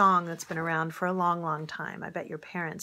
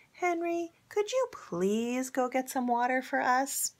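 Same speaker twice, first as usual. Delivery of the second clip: higher, gentle polite request; polite asking; 6 semitones higher than usual. Acted. Wife asking Henry nicely for water, gentle and polite. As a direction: ask nicely instead of ordering. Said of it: "I wish she had said".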